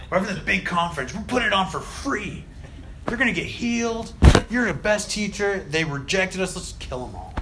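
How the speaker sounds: mock disciple outrage, pitch climbing; mock indignant rant. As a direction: ham up the offended disciples.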